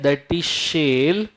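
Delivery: ordinary delivery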